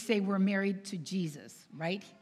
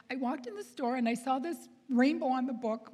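high imitation of an emotional girl; tearful caller; 4 semitones higher than usual. Crying girl tells author about finding book, tearful imitation. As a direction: retell it in her higher, choked-up voice. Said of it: "And she's crying".

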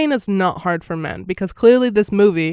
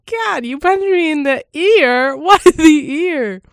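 higher, louder, laughing through the line; laughing mock outrage; laughing Edward Norton quote; 9 semitones higher, 7 dB louder, slower than usual. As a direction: quote the punched guy while cracking up. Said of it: "when he goes".

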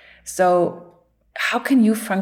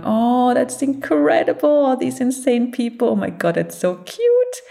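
eagle voice; 6 semitones higher than usual. Retold or imitated. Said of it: "looking down and going".

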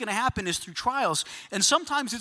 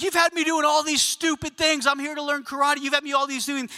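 exasperated, higher-pitched complaint; mad; 5 semitones higher than usual. Acted. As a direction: act out a student flipping out at the teacher. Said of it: "He's mad".

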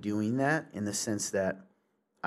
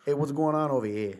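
hammed-up, louder New York accent; 4 semitones higher, 5 dB louder than usual. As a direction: play a stock New Yorker.

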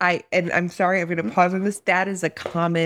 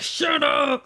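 mock angry yell; 8 semitones higher than usual. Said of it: "I'd be like".

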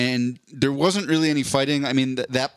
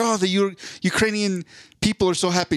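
imitating an overjoyed president, voice up high; retelling a sitcom president's delight in a high excited voice; mock excited; 7 semitones higher than usual. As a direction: gush like you just got the best news. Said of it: "And he's so excited".

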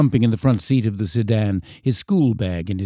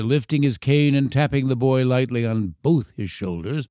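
ordinary delivery, nothing marked

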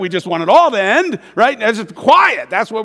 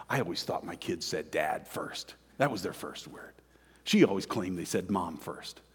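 soft whispered aside; whispering; 12 semitones lower, 13 dB quieter than usual. Real, not acted. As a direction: breathe it out quietly. Voice drops in tender reflection.

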